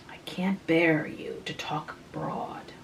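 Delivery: slow, whispered rebuke. A narrator's reading. Frances quietly chiding Tom about his speech, whispered. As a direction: breathe it out, hushed and slow.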